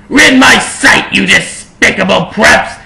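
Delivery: high, loud villain shout. A narrator's reading. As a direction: bellow it at the intruders. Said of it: "he shouted".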